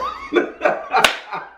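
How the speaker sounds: airy high-pitched burst of laughter; high breathless laugh. Real, not acted. Laughing at his cellmate's letter, high breathless laugh.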